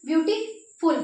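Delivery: Beautiful with a gap between beauty and फुल; word broken apart. Shown as a demonstration. Teacher asks students to break beautiful, splitting it before फुल. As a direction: pause after beauty, then place फुल alone. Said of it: "अब beautiful को तोड़िए".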